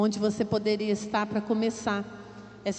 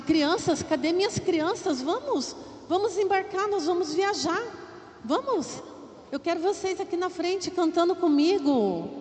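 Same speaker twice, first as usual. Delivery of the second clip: excited calling; 6 semitones higher, 3 dB quieter than usual. Real, not acted. Calling the children to board.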